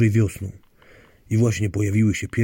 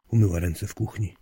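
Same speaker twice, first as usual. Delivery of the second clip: ordinary delivery, nothing marked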